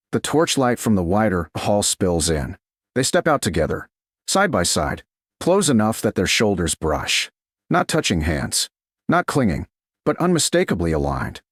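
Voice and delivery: ordinary delivery, nothing marked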